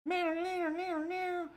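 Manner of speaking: very high squeaky meows; meowing cat. Pretend. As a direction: meow like a tiny cartoon kitten.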